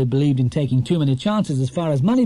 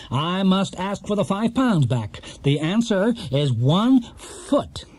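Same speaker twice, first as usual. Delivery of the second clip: triumphant quizmaster; 7 semitones higher than usual. A narrator's reading. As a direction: spell it out smugly to the bear. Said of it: "as he triumphantly banged the gong".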